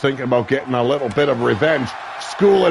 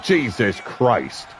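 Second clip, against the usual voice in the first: ordinary delivery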